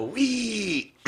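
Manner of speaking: put-on high squeal of a toddler on a ride; a high, thin toddler's wee; imitating kids. Narrator mimicking toddlers squealing on a kiddie ride, high. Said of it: "and the kids go".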